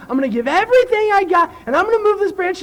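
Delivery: high, building strain into a squeal; all-out determination. Acted. Little girl vowing to move the branch, high straining determination. Girl's all-out effort acted out.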